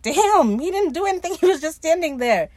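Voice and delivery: a laughing, disbelieving defence of the victim; mock outrage. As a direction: pitch it up in disbelief. Outraged for the Swede.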